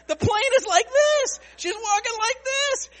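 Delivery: high loud voice imitating the shaking plane; wobbling voice. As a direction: act out the plane lurching.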